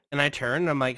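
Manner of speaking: ordinary delivery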